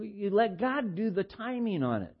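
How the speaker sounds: normal talking voice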